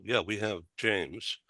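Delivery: usual speaking voice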